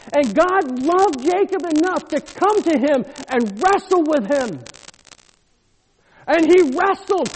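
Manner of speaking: loud, high preacher's cry; full-force preaching. Real, not acted. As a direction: preach it out to the congregation.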